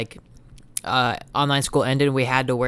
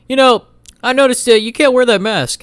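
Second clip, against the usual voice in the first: higher and louder, a mock strict teacher scolding; stern teacher voice; 9 semitones higher, 9 dB louder than usual. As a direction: play the fussy teacher calling him out, mildly hammed. History teacher confronting him in hallway.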